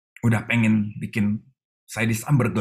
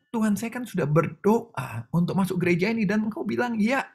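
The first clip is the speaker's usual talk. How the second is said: much higher, aggrieved questions to God; complaining prayer; 9 semitones higher than usual. Retold or imitated. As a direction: plead your disappointment upward. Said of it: "Waktu saya bilang". (mengeluh kepada Tuhan dengan nada tinggi)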